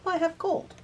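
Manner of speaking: ordinary talk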